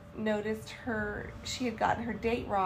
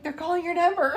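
higher and louder, hurried alert; urgent alert; 7 semitones higher, 6 dB louder, faster than usual. Retold or imitated. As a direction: tell her quickly it's her turn. Retold urgent call by husband.

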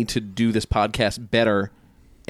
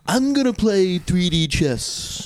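high, slow put-on Trump voice; slow, pompous rally-speech voice; Trump impression; 6 semitones higher, slower than usual. Imitating Trump on the podcast.

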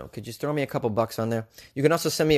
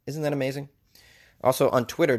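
ordinary talk

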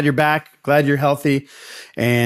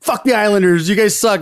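mock taunt; 6 semitones higher, 3 dB louder than usual. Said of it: "just nonchalantly".

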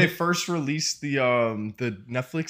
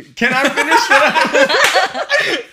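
slow, louder laughing complaint; laughing protest; 11 semitones higher, 9 dB louder, much slower than usual. Real, not acted. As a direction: drag it out while laughing, annoyed at interruptions.

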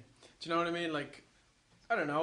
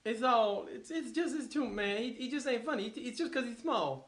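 higher, stumbling comedian chatter; Eddie Murphy; 6 semitones higher than usual. Acted. Star explaining to interviewer, high animated impression. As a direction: fumble for reasons in a raised celebrity voice. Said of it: "Can Eddie Murphy do an impression".

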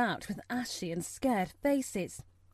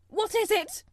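much higher, loud terrified cry; the second time nearly shrieking; panicked gasp; 13 semitones higher, 6 dB louder than usual. Acted. Mother terrified by the wailing, panicked shriek. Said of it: "Missus Lennox gasped".

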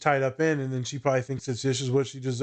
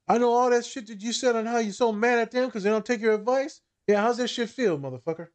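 angry boss; 9 semitones higher than usual. Pretend. Therapist venting at client.

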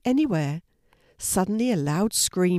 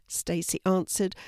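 usual speaking voice

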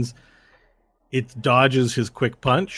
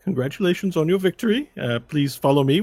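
higher-pitched, louder usher voice; polite escort; 6 semitones higher, 5 dB louder than usual. Acted. Escort congratulates the winner and leads on, high usher voice. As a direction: congratulate formally in a raised pitch. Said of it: "the escort goes".

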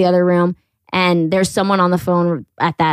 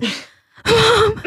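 high-pitched tearful wail for mom; hammed-up sobbing. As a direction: play a hysterical child crying down the phone. Phones mom, acting hysterical homesick child.

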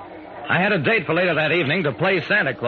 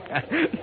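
short laugh; 12 semitones higher, 4 dB quieter than usual. Real, not acted. Amused by Southern Santa.

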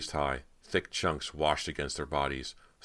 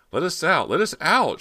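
high, loud terrified screaming; shrill terror; 11 dB louder than usual. A narrator's reading. Cody bangs on the glass, shrill terrified cry. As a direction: scream to be released, building. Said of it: "she cried in shrill terror".

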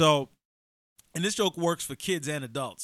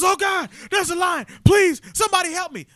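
high, loud hammed-up shriek for help; panicked screaming; 13 dB louder than usual. Man spotting a lion begs for help, comic terrified screaming. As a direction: plead at the top of your voice.

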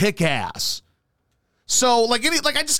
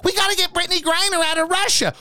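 mock whiny plea; 8 semitones higher than usual. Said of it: "people to be like".

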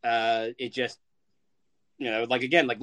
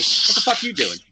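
sharp, louder, higher mock confrontation; retold angry snap; 6 semitones higher, 6 dB louder than usual. Confronting the woman who pinched him, angry outburst. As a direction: bark it back at the pincher, indignant. I was like.

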